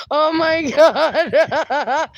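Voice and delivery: high, slow exclamation collapsing into laughter; laughing hard. Real, not acted. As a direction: drag it out and burst out laughing. Cracking up at Norma's story.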